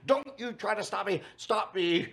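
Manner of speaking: high, loud, theatrical pleading; dramatic old queen. Retold or imitated. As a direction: camp it up, begging to be stopped. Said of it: "what if he was just an old queen".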